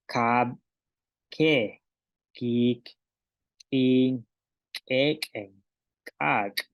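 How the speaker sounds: "Kab", "ke", "kik" con la K bien marcada, separadas; pronunciación maya. Shown as a demonstration. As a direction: como ejercicio de repetición. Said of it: "repitamos de nuevo". (maestra repite las palabras con K)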